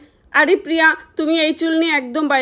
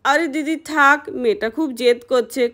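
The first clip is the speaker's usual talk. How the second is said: ordinary delivery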